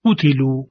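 قتلو with the u on ق kept brief; short damma demo. Shown as a demonstration. Teacher repeating the short damma example.

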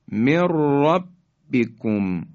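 slow mirrabbikum for من ربکم; nun merged into ra. Shown as a demonstration. Tajweed teacher repeating the merge, trilled r in من ربکم. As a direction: roll a doubled r in من ربکم, no n. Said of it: "اصطلاح را ادغام شد".

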